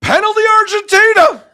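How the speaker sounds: high, loud goal-call yell; excited play-by-play shout. Real, not acted. Commentator announcing a penalty for Argentina, excited shout. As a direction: shout the penalty call at the top of your range.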